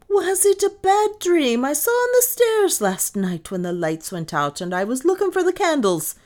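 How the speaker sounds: loud, high, indignant maid; heated retort. A narrator's reading. Maid contradicting mistress about the bad dream, heated. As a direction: fire the question back heatedly. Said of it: "said Lizzie heatedly".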